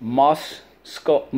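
Musco-, a fumbled false start; slip of the tongue. Real, not acted. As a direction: stumble into Musco-, then catch yourself. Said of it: "I'm sorry".

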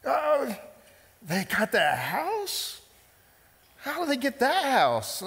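squeaky high oh, then a slow, outraged question; a hammed-up gasp of envy; a jealous neighbour cries out in mock disbelief. Jealous Facebook scroller.

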